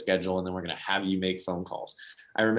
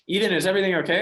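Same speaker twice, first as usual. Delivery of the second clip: high, louder alarmed concern; worried mom; 11 semitones higher, 6 dB louder, faster than usual. Retold or imitated. Basketball mom answering Ethan's call, alarmed and worried. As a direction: sound alarmed that he called. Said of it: "like, worried about me".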